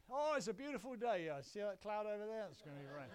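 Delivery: loud, high delight sinking into a gloomy forecast; gloomy British pessimist. Acted. Mock British grumbler spotting a cloud on a beautiful day, gloomy. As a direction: start gushing, then drop to a glum grumble. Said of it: "we are quite sort of gloomy".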